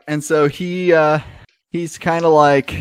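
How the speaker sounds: normal talking voice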